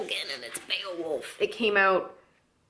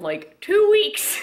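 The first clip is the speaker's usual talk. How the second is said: amazed; 10 dB louder, much slower than usual. Real, not acted. Marvels at grandma's reading speed.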